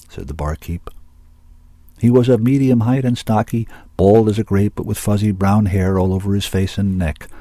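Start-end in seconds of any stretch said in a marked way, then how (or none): none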